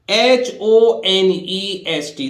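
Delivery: slow, deliberate spelling. Shown as a demonstration. Teacher spelling honest slowly for the class. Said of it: "देखिए".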